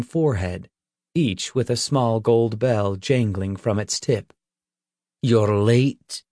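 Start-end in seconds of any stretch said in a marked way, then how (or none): 5.2-6.2 growled. Acted. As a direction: growl it out, annoyed. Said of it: "he growled".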